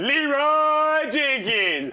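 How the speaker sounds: high, drawn-out yell of the name; battle cry shout. Retold or imitated. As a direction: hurl the name out like a charge. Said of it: "just runs off going".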